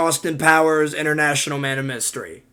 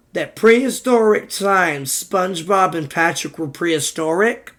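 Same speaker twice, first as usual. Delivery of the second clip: ordinary delivery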